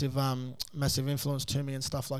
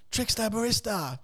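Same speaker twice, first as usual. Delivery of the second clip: mock scream; 7 semitones higher than usual. Bloke in the fishing section hailing the host, mock scream. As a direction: imitate someone hollering from down the aisle. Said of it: "screaming up to me".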